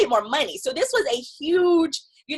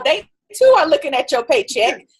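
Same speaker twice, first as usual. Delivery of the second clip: normal talking voice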